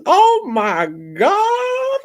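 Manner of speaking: very high, drawn-out squeal; high, stretched-out scandalised cry; mock horror. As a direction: wail it slowly in appalled falsetto. Mock horror at the line read.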